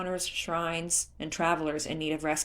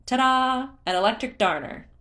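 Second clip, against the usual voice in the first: high, loud reveal; ta-da showman; 8 semitones higher, 7 dB louder than usual. A narrator's reading. Beetle showing Link a jar, revealing it with a loud ta-da. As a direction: present it like a magic trick.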